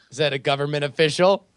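ordinary talk

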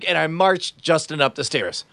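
ordinary talk, nothing marked

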